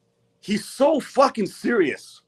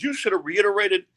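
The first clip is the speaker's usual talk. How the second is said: mimicking Eric; 6 semitones higher than usual. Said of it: "And he goes".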